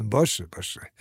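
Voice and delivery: low, soft chuckle into the words; chuckled, softened assent; laughing. A narrator's reading. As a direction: agree through a quiet laugh. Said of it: "پل خندید".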